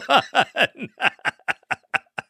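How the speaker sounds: high falling laugh; high giggle. Real, not acted. Host laughing at a caller's joke, high giggle. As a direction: burst into a giggling fit.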